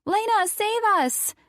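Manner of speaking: put-on very high, loud little boy's scream; screaming child. John screaming for Addie's help. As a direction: yell it with playful panic.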